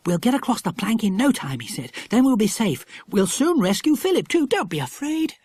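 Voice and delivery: gently calm her down; comforting boy. A narrator's reading. Jack soothing crying Lucy Ann, tender.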